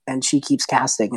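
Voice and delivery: ordinary talk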